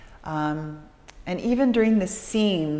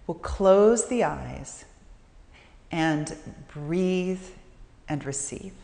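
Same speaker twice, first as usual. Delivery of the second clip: guided meditation; 2 semitones lower, 3 dB quieter than usual. Acted. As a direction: lead them gently into stillness with long pauses.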